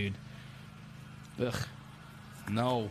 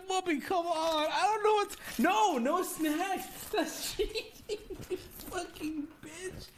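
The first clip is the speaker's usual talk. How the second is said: normal talking voice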